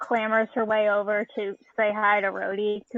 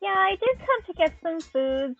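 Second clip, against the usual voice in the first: high-pitched delighted thanks; Cordelia; 9 semitones higher, slower than usual. Acted. Cordelia accepts a seat from Rhodey, brightly excited. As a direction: squeal the oh, then settle gradually. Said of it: "She sits down and is like".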